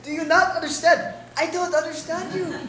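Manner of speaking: ordinary talk, nothing marked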